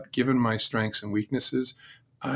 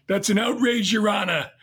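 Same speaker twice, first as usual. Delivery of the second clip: gravelly mobster shout; 10 semitones higher, 4 dB louder than usual. Retold or imitated. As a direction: imitate Fat Tony's gravelly, high-pitched outburst. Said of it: "he spoke with this gravelly voice ... he would shout out occasionally".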